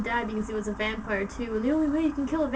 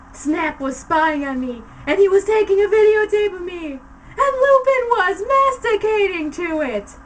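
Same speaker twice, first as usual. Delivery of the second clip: hammy stage actor; 7 semitones higher, 9 dB louder than usual. A narrator's reading. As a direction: scream it out in disgust. Narrator screams at the sight.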